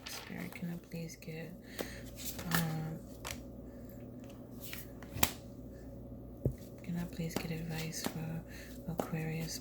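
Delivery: soft unvoiced whisper to the spirit; whispered prayer. Real, not acted. Reader whispering to the spirit for advice. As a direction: breathe the request to the spirit.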